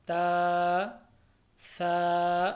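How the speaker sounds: ता, सा each with a long, level vowel; held one alif each. Shown as a demonstration. Teacher reads letters for learners, ता, सा evenly held. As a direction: draw out ता and सा evenly. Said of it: "तीनों को एक एक अलिफ के बराबर पढ़ना है".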